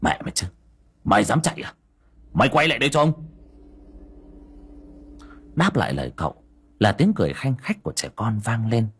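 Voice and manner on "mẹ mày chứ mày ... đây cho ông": angry cursing. Acted. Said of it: "cậu dậm chân chửi thề".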